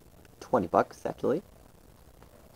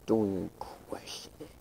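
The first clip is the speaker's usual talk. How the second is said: much softer, breathy whisper; 3 semitones lower, 11 dB quieter than usual. Acted. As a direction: breathe it like a secret. Same line voiced, then whispered.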